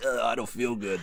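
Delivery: sick voice. Retold or imitated. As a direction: play it pitifully sick. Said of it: "that was a really good sick voice".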